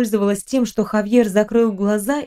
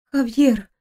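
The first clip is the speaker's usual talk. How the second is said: a name said tentatively, slightly higher; careful, tentative speech; 3 semitones higher than usual. A narrator's reading. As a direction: say it as if relearning to speak. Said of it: "словно вновь учась говорить, осторожно произнесла". (осторожно, словно учась говорить)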